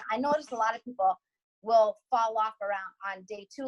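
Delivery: ordinary talk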